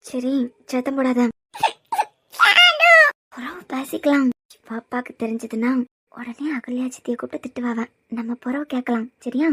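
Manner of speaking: soft, low secretive whisper; hushed whisper. Acted. Woman hushes upset child so others won't hear, whispering. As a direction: hush the child gently, keep it secret.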